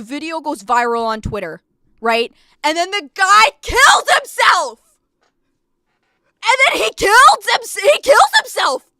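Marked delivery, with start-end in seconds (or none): 2.6-8.8 loud, high disbelieving outburst; shocked outburst. Real, not acted. Streamer telling chat the shocking twist, loud and high. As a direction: blurt it out in shock, much louder and higher. Shocked retelling of viral story.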